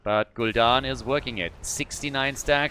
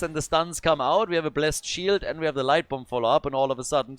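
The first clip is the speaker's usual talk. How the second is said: ordinary talk, nothing marked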